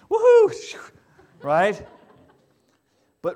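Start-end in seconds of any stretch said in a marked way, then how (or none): 0.1-0.8 gleeful woohoo. Retold or imitated. Orpah celebrating leaving.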